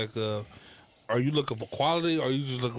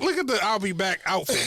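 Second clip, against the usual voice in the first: real laughter breaking into the words; laughing; 8 semitones higher than usual. Real, not acted. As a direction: crack up while pointing it out. Cracking up at an outfit.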